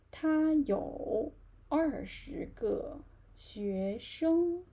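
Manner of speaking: slow, clear reading for repetition; slow model reading. Shown as a demonstration. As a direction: read it slowly so they can follow. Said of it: "Read after me".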